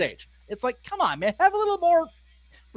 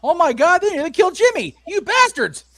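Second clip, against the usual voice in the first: high, loud shocked cry; shocked outburst; 10 semitones higher, 5 dB louder than usual. Real, not acted. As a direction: shout it in disbelief. Reacting to a dive near announcer.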